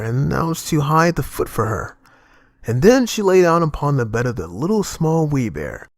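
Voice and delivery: usual speaking voice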